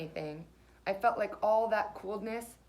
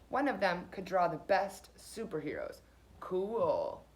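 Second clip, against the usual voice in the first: usual speaking voice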